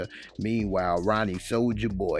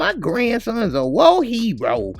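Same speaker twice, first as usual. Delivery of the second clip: put-on way up high and loud, old granny squawk; hammed-up squeaky old lady complaining; cranky grandma voice; 14 semitones higher, 8 dB louder than usual. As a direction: play a fed-up grandma yelling at the TV.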